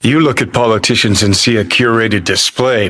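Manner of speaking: ordinary delivery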